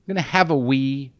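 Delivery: a dainty British grown-up, lightly mocked; quiet mock British voice. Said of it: "It's just people saying".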